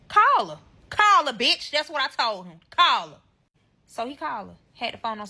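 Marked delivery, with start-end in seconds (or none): 0.1-1.7 high, loud challenge; loud demand. Retold or imitated. Woman daring boyfriend to call his mother, loud demand. 2.7-3.2 punched, louder command; loud demand. Retold or imitated. Woman ordering boyfriend to call his mother, loud demand. Acted quarrel, repeating the demand.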